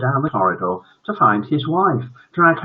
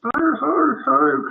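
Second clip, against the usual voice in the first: high boo-hoo, falling away; parents' mock crying; much slower than usual. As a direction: play adoring parents sobbing. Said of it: "cried his adoring parents".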